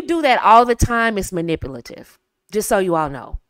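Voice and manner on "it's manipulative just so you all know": ordinary delivery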